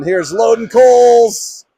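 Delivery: rising, loud announcement of a player; excited call. Real, not acted. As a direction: build up loudly to the name. Live call as player appears.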